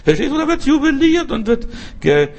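high squealing laugh through the words; laughing jubilantly. Retold or imitated. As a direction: burst out laughing with joy. Preacher acts out heavenly party. (lachend, hoch und jubelnd)

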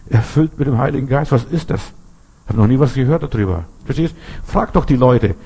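normal talking voice

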